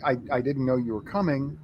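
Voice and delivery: ordinary talk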